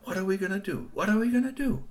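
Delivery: panicked repeating. Acted. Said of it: "they were saying".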